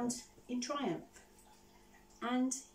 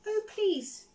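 high, louder plea to a crying dragon; little girl soothing hurt dragon; pleading comfort; 10 semitones higher, 6 dB louder than usual. Acted. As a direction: beg him kindly to stop crying.

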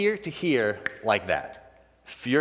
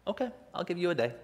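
dad giving in; 7 semitones higher, 5 dB quieter, faster than usual. Retold or imitated.

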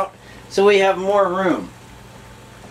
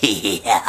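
snorting laughs; 14 semitones lower than usual. Real, not acted. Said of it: "did I just snort?".

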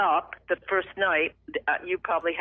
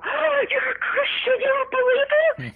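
very high, louder gush of disbelief; overjoyed squeal; 4 dB louder than usual. Retold or imitated. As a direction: burst out in squealing, ecstatic amazement. Acts out joyful outburst on hearing.

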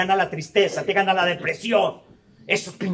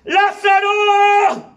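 long drawn-out shout, higher and louder; Jesus calling Lázaro from the cave, slow loud shout; 11 semitones higher, 7 dB louder, much slower than usual. Retold or imitated. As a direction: call him out of the tomb. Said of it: "le grita".

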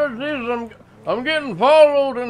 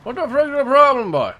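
put-on thick rural accent; 9 semitones lower than usual. Local insists this is how he always talks, thick rural accent. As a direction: lean hard on the rural accent and the boy.

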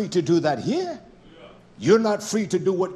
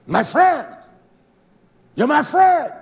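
"My friend" rising higher and louder each time; building emphatic cry; 7 semitones higher, 9 dB louder, slower than usual. Shown as a demonstration. Preacher voicing Yeshua to his people, building to a loud cry. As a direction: swell up on "friend". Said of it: "repeats my friend, building".

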